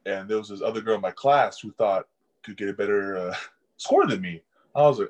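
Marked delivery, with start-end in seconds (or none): none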